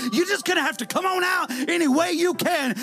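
preaching at full force. Acted. Preacher to congregation, shouting with rising fervor. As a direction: lift the room with each line.

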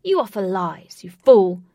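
very loud, angry shout. A narrator's reading. Wraith rejecting the villain's offer, shouted. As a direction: hurl the accusation, loudest on the insult. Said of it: "Wraith shouted".

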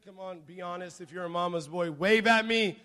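ordinary delivery

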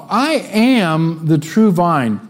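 slow, weighty scripture reading of I am the true vine; solemn reading. Shown as a demonstration. Pastor opening the passage for the congregation, solemn slow reading. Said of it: "So let's start off".

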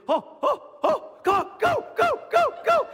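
high, loud, escalating cheers; excited sideline dad. Retold or imitated. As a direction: imitate a dad urging his kid on, building to yells.

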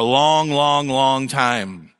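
slow, drawn-out "long, long"; stretched out. Real, not acted. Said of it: "stretched for emphasis".

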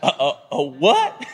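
stammering, halting disbelief; acted flustered double take; taken aback. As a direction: stumble over the words in mock shock. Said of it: "Hosea all of a sudden is like".

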